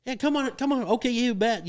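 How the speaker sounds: forced cheer. Retold or imitated. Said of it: "They'll be like".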